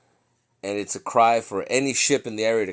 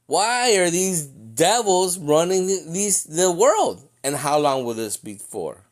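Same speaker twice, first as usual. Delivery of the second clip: pleading Ezra; 7 semitones higher, 5 dB louder than usual. Retold or imitated. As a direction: plead with God in anguish. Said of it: "he goes on saying".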